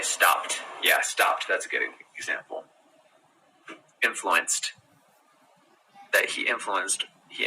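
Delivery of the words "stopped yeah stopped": "stopped" ending in a whispered t sound. Shown as a demonstration. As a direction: say "stopped" as stopt. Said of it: "you don't say".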